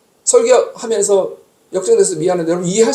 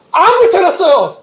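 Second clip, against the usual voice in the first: exasperated outburst; 8 semitones higher, 4 dB louder, slower than usual. Real, not acted. Finds note missing, exasperated.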